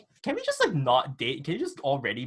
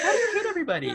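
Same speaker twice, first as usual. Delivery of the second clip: squeaky high mocking voice of people asking; mock incredulous asker; much faster than usual. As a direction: parrot the question in a silly high voice. Mocking what people ask her.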